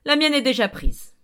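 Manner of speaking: loud, curt bark. A narrator's reading. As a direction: snap the answer like a bark. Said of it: "aboya monsieur Elliot".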